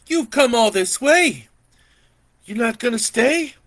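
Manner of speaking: high, loud, pleading auntie; eager auntie. Acted. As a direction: beg him to stay in a high voice. Auntie voiced.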